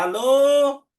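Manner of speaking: showy, loud and high phone hello. Retold or imitated. Man with a new phone answering loudly in public. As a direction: bellow hello so everyone hears you own a phone. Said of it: "फिर phone उठा के जोर से बोलता है".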